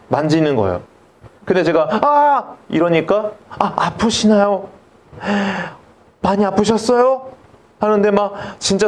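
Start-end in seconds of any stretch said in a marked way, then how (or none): 2.0-2.5 sharp high yelp of pain; a short mock cry of pain. Cries out at tooth touch. 3.5-7.3 overly caring dentist asking if it hurt; fussing doctor. Retold or imitated. Dentist fusses over his pain.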